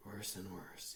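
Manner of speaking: low, soft and gloomy; gloomily. A narrator's reading. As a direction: mutter it with gloom. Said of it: "he said gloomily".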